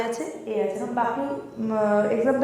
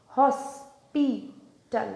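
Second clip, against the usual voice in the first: "Hospital" split slowly into three parts, pauses between; syllable by syllable; 4 dB quieter, much slower than usual. Shown as a demonstration. Teacher showing students how to break a word, syllable by syllable. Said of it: "ভাঙার চেষ্টা করো".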